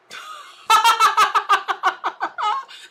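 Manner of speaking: high, loud burst of laughter; real high laugh. Real, not acted. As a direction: crack up at your own punchline. Laughs at her own joke.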